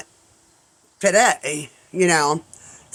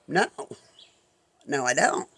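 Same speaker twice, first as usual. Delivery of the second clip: sheepish; 3 semitones lower, 7 dB quieter than usual. Retold or imitated. Retold admission of no know-how.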